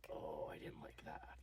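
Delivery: low, slow whisper; creeped-out whisper; uneasy whisper. Real, not acted. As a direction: hush it like he might hear. Creeped out by staring man.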